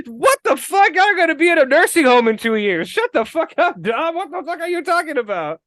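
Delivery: high-pitched disbelieving rant; incredulous mock rant. Ranting at Dom about the old couple, high disbelief. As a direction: go off on them in disbelief. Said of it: "I'm like".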